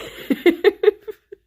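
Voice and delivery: loud, high laughter; high giggling laugh. Real, not acted. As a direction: burst out laughing at the bad news. Laughing at positive covid result.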